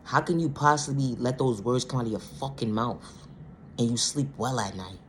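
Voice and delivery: usual speaking voice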